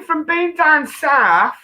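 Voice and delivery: put-on southern English accent, drawn out. Shown as a demonstration. Co-host shows guest her attempted southern accent. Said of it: "that's my southern accent".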